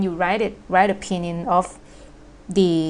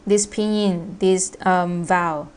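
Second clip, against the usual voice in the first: ordinary talk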